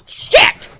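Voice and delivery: sudden high yell; real shout. Real, not acted. Startled as the copperhead slips away, high loud yell. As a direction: blurt it out in shock. Snake escapes.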